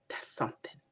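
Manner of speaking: a soft, awed whisper of praise; hushed whisper. Retold or imitated. Granddaughter retelling grandma's hushed praise, whispering. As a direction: breathe it out in a whisper, moved. Grandma tasting cake, emotional.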